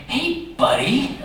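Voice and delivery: very high, slow, fake-sweet needling; mock concern. Cheated giver leaning into the faker's car, mock concern. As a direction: draw it out in falsetto, oozing false friendliness.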